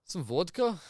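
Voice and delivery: hammed-up Russian voice; Russian accent. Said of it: "Can you do, like, a Russian accent?".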